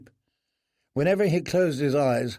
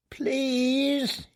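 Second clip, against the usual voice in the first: thin weak squeak; 12 semitones higher than usual. Acted. Prisoner begging the boys, thin weak squeak. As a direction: squeak it out like a hundred-year-old man. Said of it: "he squeaked, his voice thin and weak".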